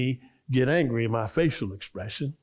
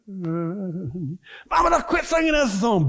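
mock yell; 6 semitones higher, slower than usual. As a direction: shout it at the singing grandmother. Mother shouts at grandmother.

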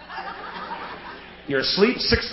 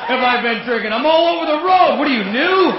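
put-on louder high drunk taunt; silly clown; 7 semitones higher, 4 dB louder than usual. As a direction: sneer it at the cop, shouting. Drunk mocking the cop again.

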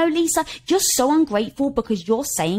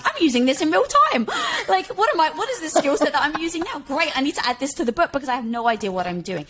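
high-pitched, gasping comic alarm; hammed-up flustered self-talk; mock panicked realisation; 5 semitones higher, faster than usual. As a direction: play a sudden realisation, pitched up. Acting out her own panicked realisation.

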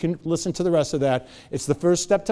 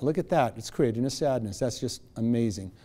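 softer, lower, quietly marvelling; mild, understated wonder; calm detached noticing; 4 semitones lower, 6 dB quieter than usual. Shown as a demonstration. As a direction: observe the sadness from a distance. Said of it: "I watch that".

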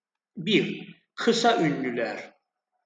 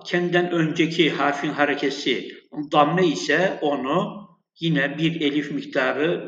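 usual speaking voice

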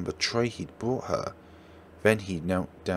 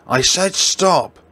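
furious shout; 9 semitones higher, 10 dB louder, slower than usual. Acted. Girlfriend screaming at her boyfriend to stop. Couple's quarrel explodes.

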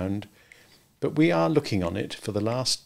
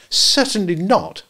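scandalised refusal; 9 semitones higher, 4 dB louder, slower than usual. A narrator's reading. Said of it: "Wendy was scandalised".

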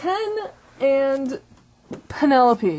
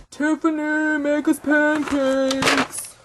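drawn-out, raised-pitch call; 5 semitones higher, slower than usual. Acted. Ken ordering Tiffany to cook, drawn-out call. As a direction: sing out the order slowly.

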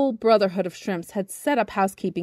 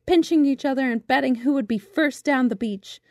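usual speaking voice

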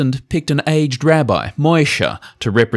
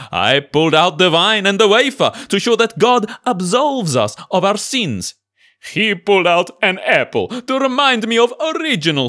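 high, earnest Pope voice; 8 semitones higher than usual. Acted. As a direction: speak as the puzzled Pope. Pope explains to cardinals.

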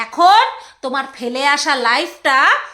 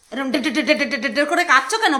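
fast wobbling de de de imitating shaky legs; mocking a yoga teacher's shaking legs; mock trembling; 3 semitones lower, faster than usual. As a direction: rattle off de de de like a shaking leg. Mimics shaking.

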